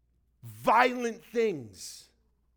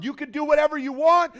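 much higher, much louder mock boast; mocking bold claim; 9 semitones higher, 12 dB louder than usual. Preacher mocking the wrong idea of grace, loud boast. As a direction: belt it out like the misguided idea of grace. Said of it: "that's not what grace is".

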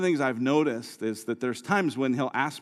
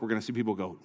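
ordinary talk, nothing marked

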